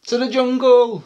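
high, loud little voice; baby elephant. A narrator's reading. Baby elephant answering mum where she'll go, high and eager. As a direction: announce it like an eager youngster. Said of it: "said the baby elephant".

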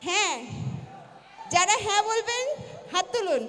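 formal vote call. Acted. Chairs a mock yes/no vote.

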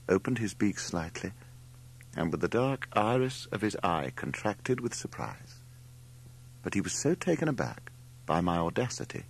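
ordinary delivery

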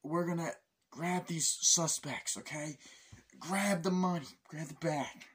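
hammed-up agent yelling; mock interrogation. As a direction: bark orders at the suspects. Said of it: "It's like".

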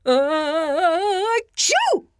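big loud sneeze. A narrator's reading. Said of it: "a loud noise".

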